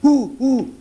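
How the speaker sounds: high, loud eager cry; excited exclamation. A narrator's reading. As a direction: burst out with an urgent question. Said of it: "s'exclama Sajo".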